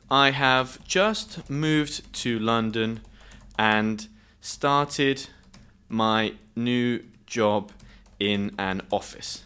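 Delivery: slowly sounded out while typing; slow dictation. Real, not acted. Teacher typing a story prompt for learners, slow dictation. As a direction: dictate word by word with pauses.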